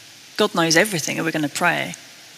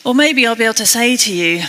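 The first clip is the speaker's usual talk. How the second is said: ordinary delivery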